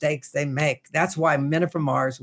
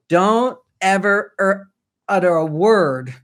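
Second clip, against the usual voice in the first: slow, stretched out, each word hammered and a bit louder; slow emphatic warning; 2 semitones higher, 4 dB louder, slower than usual. Shown as a demonstration. Coach warning men: slow, stressed don't ever utter a word. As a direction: lay down the rule word by word, slowly. Teaching men a firm rule.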